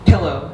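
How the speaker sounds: "Pillow" with a short i, as in pill; teacher's standard pronunciation. Retold or imitated. Second grade teacher forcing a child to say it, "Pillow" with short i. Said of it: "forces me to say the word pillow".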